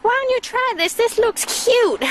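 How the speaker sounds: high, sweet mock mom voice. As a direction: chirp it like a coaxing mom. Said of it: "And she's like".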